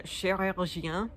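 chirurgien said slowly, each syllable drawn out; French chirurgien. Shown as a demonstration. Vlogger savouring a fun French word, slowly. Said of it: "surgeon is always fun".

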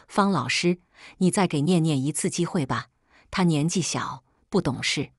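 ordinary delivery

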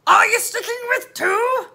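loud, slow, drawn-out question; gruff officer querying pointedly; loud police voice, doubting her; policeman. Acted. Policeman quizzing the girl.

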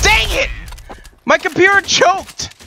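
high, frustrated yelp; exasperated outburst. Real, not acted. Player whose computer just choked, exasperated. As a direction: cry out high, drawing out the complaint. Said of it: "Are you okay?".

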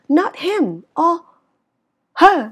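"him" and "her" high, loud, slow; contrastive stress. Shown as a demonstration. Teacher ruling out anyone else, contrastive stress.

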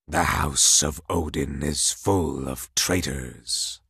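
low, slow, distant menace; cryptic frost king. Acted. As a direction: speak slowly like an ancient cold king. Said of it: "Laufey said cryptically".

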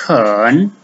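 "Hen" in a low, dipping third tone; Mandarin third tone. Shown as a demonstration. Teacher showing learners the tone on hen, third tone. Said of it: "the third tone".

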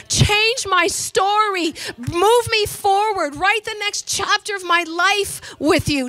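high, loud, slow plea; preacher voices a believer crying to Jesus, high and slow; pleading prayer. Acted. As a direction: cry out to God slowly and loud. Preacher voicing prayer, building.

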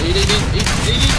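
rising urgent shout. Real, not acted. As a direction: yell it fast as danger hits. Urgent callout in firefight.

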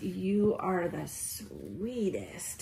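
slow soft whisper; hushed tender whisper. Real, not acted. Whispering to doll.

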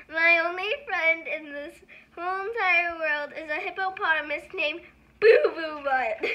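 luid gespeeld snikkend; mock sobbing. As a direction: jammer het half huilend. Forced silly line, near tears.

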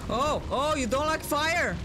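high mocking taunt. Real, not acted. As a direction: tease the boss, sing-song. Taunting a boss with fire.

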